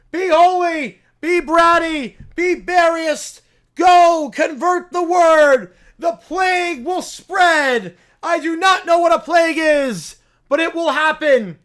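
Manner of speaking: high, loud zealot sermon to the rats; rally the rodents like a mad prophet; preaching bear. Acted.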